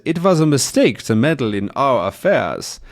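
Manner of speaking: put-on German villain accent. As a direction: threaten in a mock German accent. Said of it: "that's my German accent again".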